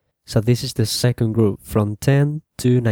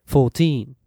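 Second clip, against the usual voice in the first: put-on "Fourteen" as four-TEEN, long "teen"; stress on final syllable; 2 semitones higher than usual. Shown as a demonstration. Teacher repeats the correct form, "Fourteen" stressed on TEEN. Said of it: "on the final syllable".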